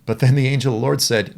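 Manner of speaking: ordinary talk, nothing marked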